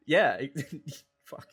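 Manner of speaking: breaking into laughter; laughing. Real, not acted. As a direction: laugh through the words. Both cracking up at the joke.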